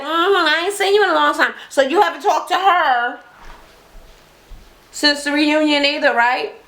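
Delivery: high, knowing, prodding voice; suspicious twins. Retold or imitated. Twins grilling Juicy about the reunion, high and knowing. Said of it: "They was like".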